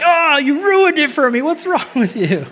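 teasing wife's high-pitched complaint; mock exasperated wife. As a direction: play the annoyed wife, half-laughing. Pastor voices wife scolding him.

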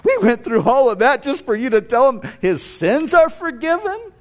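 indignant high-pitched protest, acted out; incredulous mock grievance; disappointed disbelief. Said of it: "they were probably a little disappointed".

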